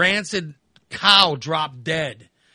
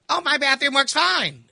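innocent mother; 9 semitones higher than usual. Retold or imitated. Mother answering.

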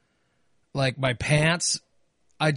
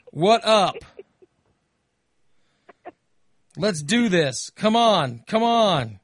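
pestering texts read out; 3 semitones higher, much slower than usual. Retold or imitated. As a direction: reel off the nagging texts like an impatient friend. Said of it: "Here come the text".

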